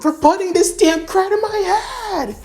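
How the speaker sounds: high furious villain shriek. Acted. Villain shrieking at Noctis in rage. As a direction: scream the threat way up high.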